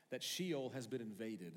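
usual speaking voice

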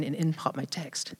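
dropped way down, almost under the breath; quiet, awed payoff line; hushed reveal. Real, not acted. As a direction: let the punchline land softly in awe. Story's dramatic reveal moment.

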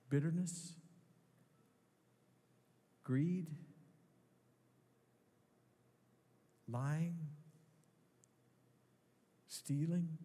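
gentle pastor guiding a time of prayer; prayerful reflection. Acted. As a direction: let each sin hang in the silence.

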